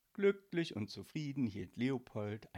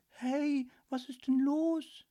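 comforting; 7 dB louder, slower than usual. Acted. Marie comforting Leopold.

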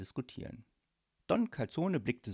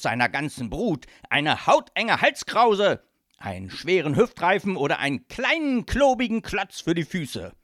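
mafia boss swelling to loud, emphatic threats; ranting godfather; 9 dB louder than usual. Acted. Godfather describes punishing non-payers, loud gleeful rant. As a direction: hammer out each threat loudly.

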